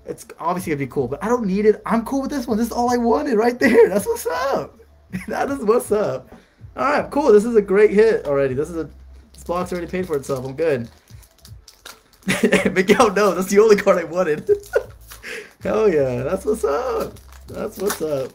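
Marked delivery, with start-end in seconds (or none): 1.1-8.5 loud, high-pitched, jubilant; excited celebration. Real, not acted. Telling viewers this hit is all he wanted, jubilant. Celebrating his chase card pull. 12.3-18.3 loud, raised, gleeful; laughing excitement. Real, not acted. Telling Miguel it's the only card wanted, gleeful and loud. Still celebrating, tells Miguel.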